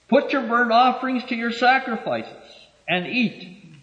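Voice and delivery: high, scornful go-ahead; God scornfully telling them to carry on; dismissive. Acted. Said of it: "the tone of his voice was".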